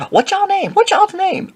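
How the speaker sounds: very high squeaky voice; exaggerated high-pitched caricature; motor-mouthed woman. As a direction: squeak it like a nosy neighbor. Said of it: "She was like".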